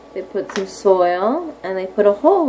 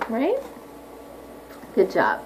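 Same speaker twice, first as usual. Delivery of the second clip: normal talking voice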